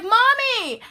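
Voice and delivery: high, loud call to mom; excited shout for mommy. Retold or imitated. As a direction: call across the lot. Calling out to car.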